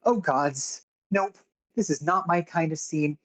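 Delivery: high-pitched, fed-up character voice; reluctant accountant. Acted. Accountant begging out of a fight with armed strangers, high reluctant voice. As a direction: pitch it up, pleading to be left out of it. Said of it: "leans back and goes".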